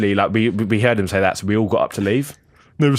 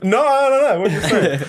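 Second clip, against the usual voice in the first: mock protest; 9 semitones higher than usual. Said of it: "I'm sitting there like".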